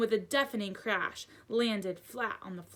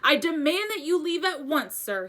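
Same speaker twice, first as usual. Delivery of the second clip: a hint of a pompous uncle; mildly blustering uncle; indignant uncle; 7 semitones higher, 7 dB louder than usual. A narrator's reading. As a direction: demand he leave, stiffly. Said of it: "he said".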